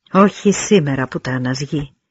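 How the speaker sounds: plain reading voice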